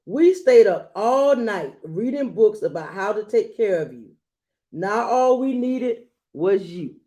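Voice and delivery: put-on gentle read-aloud to a child; storybook reading voice; story narration. A narrator's reading. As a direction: read it like a bedtime picture book. Reads picture book aloud.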